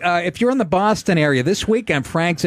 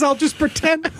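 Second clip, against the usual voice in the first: higher, far voice as Grodin; Grodin impression; 12 semitones higher than usual. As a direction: play Grodin asking to fake the cuffs. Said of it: "Grodin says".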